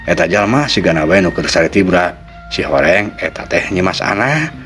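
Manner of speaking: usual speaking voice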